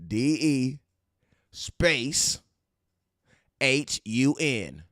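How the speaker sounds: letters given one by one, very slow; slowly spelling his middle name for viewers. Shown as a demonstration. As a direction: spell it out deliberately. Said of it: "it's actually spelled".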